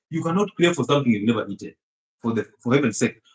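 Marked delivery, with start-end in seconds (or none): none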